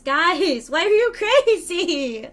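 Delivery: high, loud, laughing disbelief; exasperated outburst. Pretend. Streamer to an unruly chat, in laughing exasperation. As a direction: cry out in amused exasperation.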